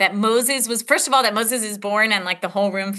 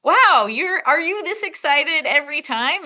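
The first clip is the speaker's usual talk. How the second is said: higher, louder, amazed "Wow"; mock amazement at the doctor's enthusiasm; amused surprise; 8 semitones higher, 3 dB louder than usual. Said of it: "And I was like".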